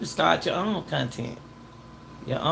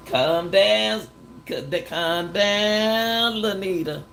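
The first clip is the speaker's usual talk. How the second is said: higher, stretched-out "calm down" imitation; long, raised mock soothing; drawn-out calming call; 5 semitones higher, 3 dB louder, much slower than usual. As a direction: imitate someone dragging out a plea to settle down. Mimics others telling her to calm down.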